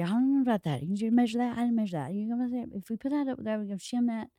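soft, run-together imitation of muttering brothers; two alike brothers. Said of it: "they talk exactly the same".

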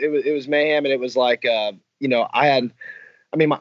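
ordinary talk, nothing marked

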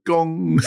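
deadpan spoken gong bong; imitated gong. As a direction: drop the gong as a punchline. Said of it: "they go".

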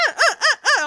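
loud, high mock punching grunts; imitated fighting grunts. Imitating little kids beating up Aemond, high comic grunts. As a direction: grunt out each punch, playfully.

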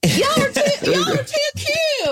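very high, squealed Southern voice; Southern accent. Shown as a demonstration. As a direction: coo it high in a Southern twang. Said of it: "just a sentence of a Southern accent".

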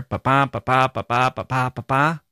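"Ba-ba" as a weak-strong pulse, second syllable stressed; iambic beat. Shown as a demonstration. Teacher sounding out the meter, "Ba-ba" stressed on the second beat. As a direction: chant "Ba-ba, ba-ba" in five even da-DUM beats. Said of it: "read it in iambic pentameter".

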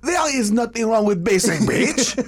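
high mock French accent. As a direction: proclaim it as a hammed-up Frenchman. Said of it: "we are talking in French".

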